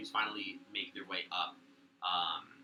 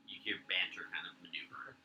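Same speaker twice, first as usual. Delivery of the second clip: normal talking voice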